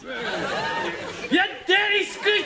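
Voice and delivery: high, loud, drawn-out mock yell; imitating the Scotsman. Prisoner copies the Scot's rant at the guards, hammed-up and slow. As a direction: copy the movie prisoner's rage, hammed-up. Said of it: "I've gotta do that".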